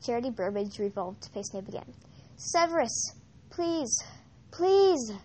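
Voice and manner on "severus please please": much higher, rising to a louder begging cry; a terrified prisoner begging, growing louder; desperate pleading. Acted. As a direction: plead for your life. Captive begging Snape for help.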